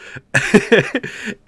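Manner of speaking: short high chuckle; amused burst of laughter. Real, not acted. Host chuckling at their own remark, high laugh.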